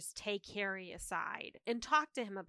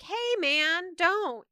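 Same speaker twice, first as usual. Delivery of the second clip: mock plea; 7 semitones higher, 5 dB louder, much slower than usual. Harry voiced in recap.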